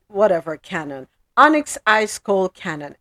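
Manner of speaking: ordinary talk, nothing marked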